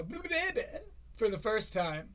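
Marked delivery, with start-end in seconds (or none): 0.0-0.6 put-on much higher childish squeak; mock kid babble. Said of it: "heard that kid go".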